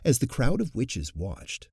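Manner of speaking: ordinary delivery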